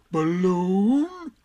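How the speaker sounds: loud, drawn-out donkey wonder, rising; Eeyore. A narrator's reading. Eeyore hears of a balloon, loud and amazed. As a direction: swell the word upward in amazement. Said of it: "said Eeyore".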